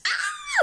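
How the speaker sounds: very high, loud mock squeal; excited squeal. Singer relives falling for opera, high excited squeal. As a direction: let out a high squeal of delight. Squeal re-enacting her delight.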